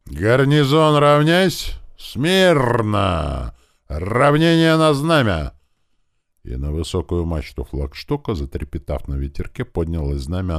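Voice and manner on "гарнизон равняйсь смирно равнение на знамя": high, loud, drawn-out parade order; military command. A narrator's reading. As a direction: call the garrison to attention. (громкая строевая команда)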